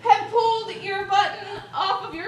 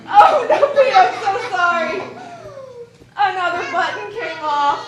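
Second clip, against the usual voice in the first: giggling oh no, louder; laughing apology; 2 semitones higher, 5 dB louder than usual. Real, not acted. Teacher laughs as she breaks Pete's button. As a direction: laugh through the sorry.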